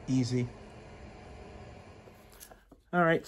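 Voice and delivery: ordinary talk, nothing marked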